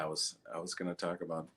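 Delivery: ordinary delivery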